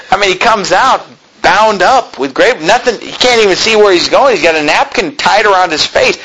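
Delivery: usual speaking voice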